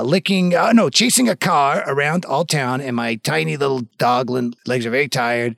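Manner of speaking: ordinary talk, nothing marked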